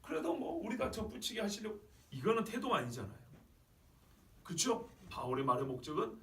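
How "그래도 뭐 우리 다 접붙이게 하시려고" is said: high-pitched, offhand dismissal; mock a smug, unrepentant shrug; cynical, disagreeable. Preacher imitating someone still unmoved by the warning, cynical. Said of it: "여전히 냉소적으로 기분 나쁘게".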